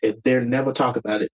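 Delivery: ordinary talk, nothing marked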